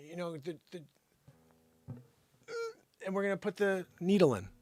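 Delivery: ordinary delivery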